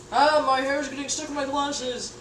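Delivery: ordinary talk, nothing marked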